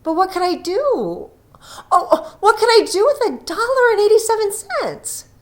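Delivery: anguished lament. Acted. Della cries out over her cut hair, wailing high.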